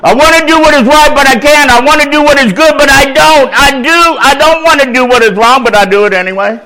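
high, loud preached refrain; preaching cadence. Acted. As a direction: preach the struggle with sin. Preaching Romans passage.

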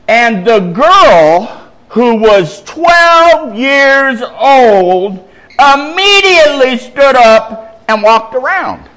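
high, drawn-out triumphant declaiming; scripture climax. A narrator's reading. Preacher telling congregation the girl rose, declaiming.

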